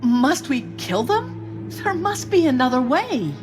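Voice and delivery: high, distressed plea; distressed pleading. Acted. Accomplice begs her partner not to kill, distressed pleading. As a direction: plead against it, pitch far up.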